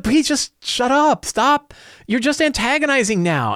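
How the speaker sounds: high, slow pleading exasperation; exasperated mercenaries. Acted. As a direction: beg him to stop, at the end of your rope. Exasperated mercenaries beg priest.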